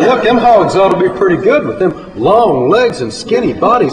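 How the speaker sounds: high, lively sales pitch; eager hype. Retold or imitated. Narrator talks up his friend's skinny hogs, eager. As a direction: talk the hogs up high and animated. Teller hypes hogs to gullible John.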